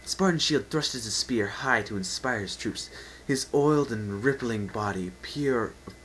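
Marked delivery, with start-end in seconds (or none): none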